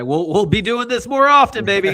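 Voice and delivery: loud, high, excited promise; hyped-up. Real, not acted. Host hyping the guests on the way out, loud and excited. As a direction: pump up the crowd.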